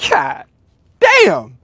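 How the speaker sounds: damn jumps way up in real shock; stunned by the number, let it burst out high; shocked exclamation. Real, not acted.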